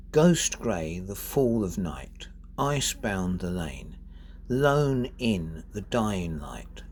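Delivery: unhurried poetry reading; poem recitation. Acted. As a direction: read the stanza out slowly, line by line. Reads the poem aloud.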